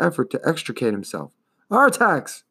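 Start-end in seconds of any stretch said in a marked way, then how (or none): 1.7-2.4 high, alarmed call of the name; crying out. A narrator's reading. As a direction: call out to a sinking friend. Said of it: "cried Atreyu".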